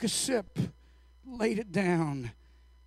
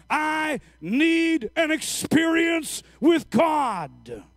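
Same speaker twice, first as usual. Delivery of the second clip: full-force preaching; 6 semitones higher, 6 dB louder than usual. Real, not acted. Preacher urging people to seek God alone, full-force preaching. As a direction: build the plea until it is shouted.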